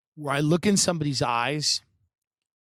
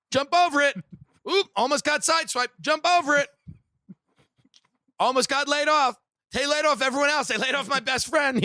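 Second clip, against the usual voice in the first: high, loud, fast gamer urgency; mock frantic player; playing Mario; 11 semitones higher, 4 dB louder than usual. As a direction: narrate a video game level like you're mid-jump. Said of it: "they're like Mario".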